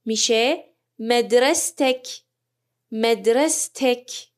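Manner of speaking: مدرستک drawn out slowly, "madrasatak"; slow Arabic demo. Shown as a demonstration. Teacher modelling مدرستک as "madrasatak" for students, slow.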